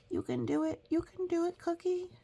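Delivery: tiny high cheer-up voice; whisper-cheer yourself on, up high; high little inner cheerleader; high encouraging voice. Acted.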